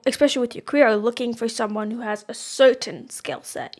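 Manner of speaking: usual speaking voice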